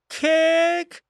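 high, hoarse falcon screech; hoarse falcon cry. A narrator's reading. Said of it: "хрипло ответил сокол".

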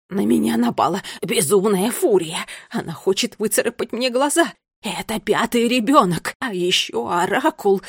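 loud, frantic lament; panicked complaint. A narrator's reading. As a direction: wail the grievances out loudly. Panicked creature complains to the queen. (громкая паническая жалоба)

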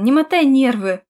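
loud, irritated warning; hissed. A narrator's reading. Husband fed up with nagging wife, hissed loudly. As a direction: warn her off with force. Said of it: "прошипел Хиян".

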